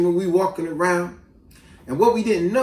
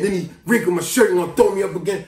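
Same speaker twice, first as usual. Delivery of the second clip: ordinary talk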